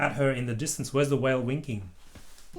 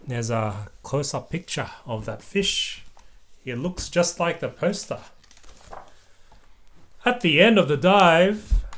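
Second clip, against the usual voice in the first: usual speaking voice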